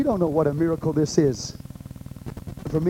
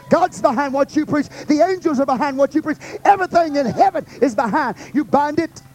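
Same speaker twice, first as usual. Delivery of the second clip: full preaching; 7 semitones higher than usual. Acted. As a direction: build the sermon to a peak.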